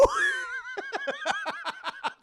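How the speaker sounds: real high burst of laughter; a shrieking laugh at the joke. Real, not acted. Laughing at own bit.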